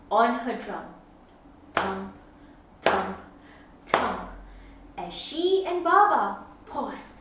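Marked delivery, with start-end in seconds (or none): none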